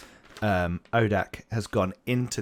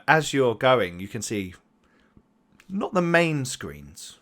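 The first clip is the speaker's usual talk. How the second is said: ordinary talk, nothing marked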